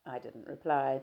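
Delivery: usual speaking voice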